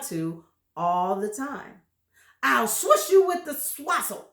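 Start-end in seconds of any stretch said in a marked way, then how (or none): 2.4-4.3 high, loud witchy threat; furious shout. A narrator's reading. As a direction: screech the threat down from the sky. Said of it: "she shouted".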